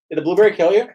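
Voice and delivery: ordinary delivery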